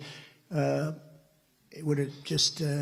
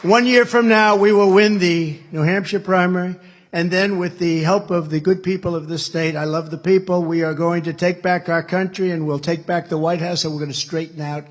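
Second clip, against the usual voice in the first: rally speech; 2 semitones higher, 7 dB louder than usual. Acted. Rally climax to crowd.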